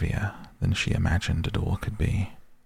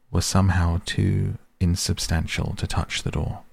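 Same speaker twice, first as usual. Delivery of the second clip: ordinary delivery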